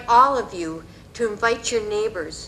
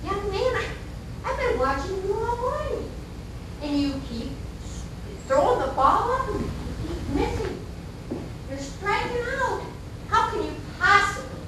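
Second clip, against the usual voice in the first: lightly acted, high-pitched bafflement; baffled gentleman; 10 semitones higher than usual. Gentleman questioning the little boy on the field, mildly baffled. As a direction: reason with a kid in high, halting disbelief.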